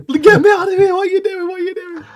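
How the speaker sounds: squealing high panic, far up the voice; a frantic, shrieking coward begging to escape; panicked Zenitsu. Retold or imitated. Mimicking Zenitsu's panic to a friend, high squealing shriek.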